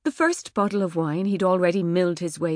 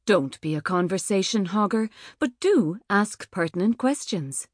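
ordinary delivery

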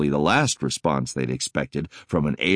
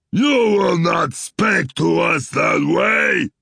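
slow, loud, mangled-English threat; angry alien creature shouting; snarling alien; 14 semitones higher, 7 dB louder, slower than usual. Acted.

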